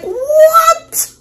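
very high, loud shocked cry; shocked what why. Retold or imitated. Retelling her reaction to the singer, a high astonished cry. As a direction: squeal it out in disbelief. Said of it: "I'm like".

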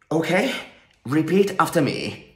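normal talking voice

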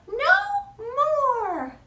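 high little mouse voice; squeaky mouse voice. Acted. Mouse voice in rhyme.

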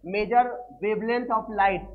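slow dictation. Shown as a demonstration. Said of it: "इसका जो use करते हैं".

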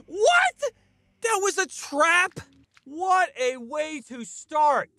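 high, loud disbelieving shout, then a slow groan; shocked outrage. Real, not acted. Gamer caught by a trap, shrieking in high, loud disbelief.

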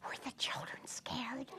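hushed whispered question. Acted. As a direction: breathe it like a secret. Storyteller whispers question to kids.

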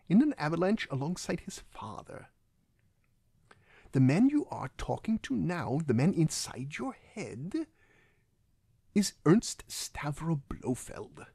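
very precise, mannered villain delivery; slow down and place each word precisely, like a theatrical villain; Christoph Waltz. Acted. Villain revealing his name to his captive, precise mannered impression. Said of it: "he has this very precise".

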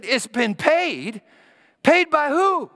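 high, loud disbelieving "Paid by who?"; can't believe it; stunned woman questioning the milkman; astonished. Retold or imitated. Woman astonished at forgiven debt.

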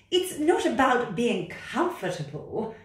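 loud, high, bossy cat voice. Acted. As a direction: order the frog about like a know-it-all cat. Cat character.

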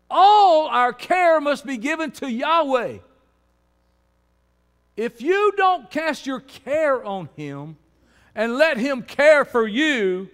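slow, loud, high preaching; full-force pulpit shout, words stretched; impassioned exhortation. Real, not acted. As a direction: hammer each phrase out to the congregation.

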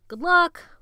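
high, loud call after someone; shout. A narrator's reading. Walter shouting after departing Jonathan, high shout. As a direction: shout it after him as he leaves. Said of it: "Walter shouted after him".

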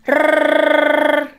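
trilled ルルルルルルルル, held; rolled r. Shown as a demonstration. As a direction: flutter the tongue on ルルルルルルルル. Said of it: "ルルルルルの練習". (巻き舌でルルルルルルルル)

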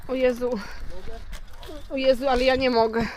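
talking while being shaken about; on a bumpy road. Real, not acted. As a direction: voice jolted by a bumpy ride. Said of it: "Ale trzęsie".

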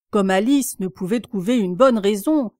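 plain reading voice

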